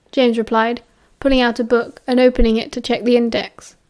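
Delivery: usual speaking voice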